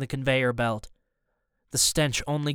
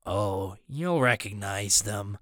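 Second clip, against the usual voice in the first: low, slow, softer villain; quietly menacing villain; accent dropped, menacing; 6 semitones lower, 4 dB quieter, slower than usual. A narrator's reading. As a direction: let it sink low and slow, knowingly. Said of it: "the fake accent gone".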